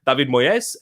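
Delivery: David Moyes said "Dah-vid Moy-es", Spanish-style; exotic pronunciation. Retold or imitated. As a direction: say Moyes in two syllables, "Moy-es". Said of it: "That's probably how they pronounced it".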